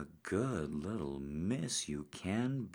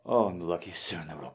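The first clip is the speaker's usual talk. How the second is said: gym bro; 2 semitones higher, 8 dB louder, much faster than usual. Acted. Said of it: "cheering himself on".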